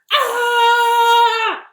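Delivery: long, loud high-pitched yell; sustained storybook scream of alarm; frightened scream. Acted. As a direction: shriek in acted terror, holding it out. Fox screams at Gruffalo.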